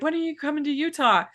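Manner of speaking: mock-pleading as a waiting fan; retold fan plea.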